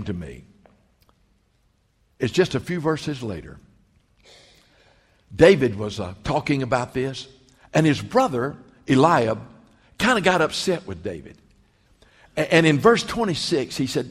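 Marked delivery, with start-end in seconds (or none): none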